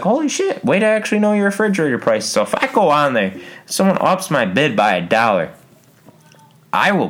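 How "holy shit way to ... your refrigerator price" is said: much higher, fast amazed exclamation; amazed cheer. Retold or imitated. Viewer amazed at a close bid, high fast exclamation. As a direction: marvel at a perfect guess. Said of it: "'cause it's like".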